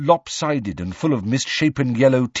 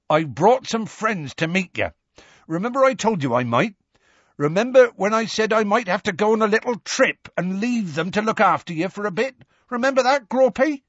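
higher, big-hearted giant's coaxing call; Hagrid coaxing; 10 semitones higher than usual. A narrator's reading. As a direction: coax his giant brother like a child.

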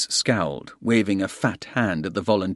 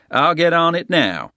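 lightly voiced hotel manager; Crowder's voice; 8 semitones higher, 5 dB louder than usual. A narrator's reading.

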